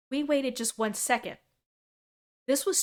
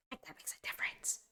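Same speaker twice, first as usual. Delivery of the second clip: whispered aside; 5 semitones lower, 13 dB quieter, faster than usual. Shown as a demonstration. Streamer repeating her point to chat as an aside, whispered.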